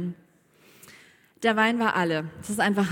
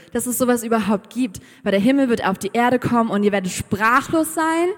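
loud, excited announcement to a crowd; Jesus als großspuriger Ankündiger; 2 semitones higher, 7 dB louder than usual. Acted. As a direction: proclaim it big, building in volume. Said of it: "Jesus ist nicht da an der Hochzeit und hat gesagt". (lautstark angeberische Ankündigung)

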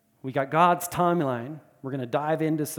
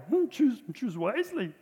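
high, creaky little Yoda voice with a laugh at the end; Yoda impression; 9 semitones higher than usual. Pastor imitating Yoda for the congregation, high and laughing. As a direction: play the tiny wise master, higher pitch, laughing through it. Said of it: "Not only did Yoda say that to Luke Skywalker".

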